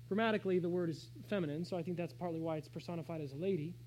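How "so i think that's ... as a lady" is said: ordinary delivery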